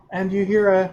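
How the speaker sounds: usual speaking voice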